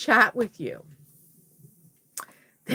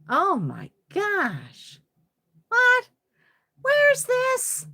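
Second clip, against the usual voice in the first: shocked; 13 semitones higher, 4 dB louder, much slower than usual. Real, not acted. Shocked by spider photo.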